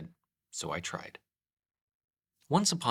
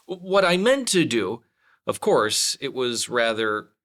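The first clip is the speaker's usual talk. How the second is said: flustered, loud explaining; 8 dB louder than usual. Acted. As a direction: gabble it out while crashing down. Pooh explaining while falling.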